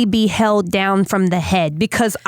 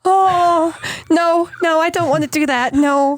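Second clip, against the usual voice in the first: high-pitched, recoiling protest; squeamish refusal; 8 semitones higher than usual. Real, not acted. Listener recoiling from the camera-in-throat story, squeamish. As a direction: back away from it, pitch right up.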